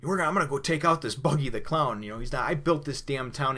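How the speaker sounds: high, loud, outraged retold rant; hammed-up fury of the old mayor; furious mayor. Mayor furious, vowing revenge.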